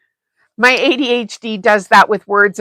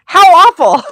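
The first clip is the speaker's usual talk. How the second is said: high, louder, laughing through it; mock dismay, laughing; 9 semitones higher, 6 dB louder than usual. Host to chat, joking that solitude is awful: laughing mock dismay. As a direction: pretend to be appalled while laughing.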